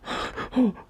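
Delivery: high frightened gasp; sharp gasp. Real, not acted. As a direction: gasp in sudden shock. Sudden fright.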